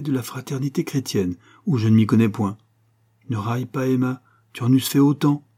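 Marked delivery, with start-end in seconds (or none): none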